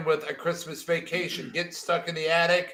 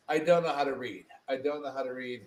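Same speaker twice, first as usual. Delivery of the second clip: ordinary talk, nothing marked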